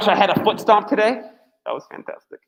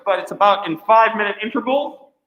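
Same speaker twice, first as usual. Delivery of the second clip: ordinary talk, nothing marked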